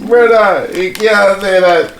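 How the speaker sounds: high, drawn-out loud protest. Acted. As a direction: shout it out slowly, high-pitched. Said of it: "No, all loud and that".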